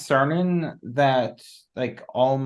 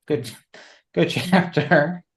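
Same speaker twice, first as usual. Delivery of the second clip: laughing; 5 semitones higher than usual. Real, not acted. Laughs mid-phrase.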